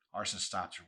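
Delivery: ordinary delivery, nothing marked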